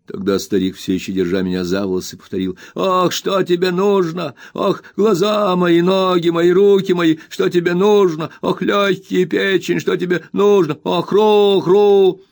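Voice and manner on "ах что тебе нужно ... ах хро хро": put-on high, slow, whining old miser's wail; much higher, drawn-out spiteful whimper; spiteful whining old man. A narrator's reading. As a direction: whine it out spitefully like a crazed old junk dealer. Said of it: "Это слово он выкрикнул".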